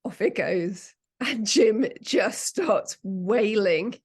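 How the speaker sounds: ordinary talk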